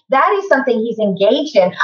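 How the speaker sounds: ordinary delivery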